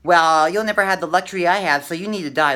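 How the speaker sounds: loud mock yelling at a doubter; start yelling. Playing a viewer arguing back, loud mock yell. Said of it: "to kinda start yelling".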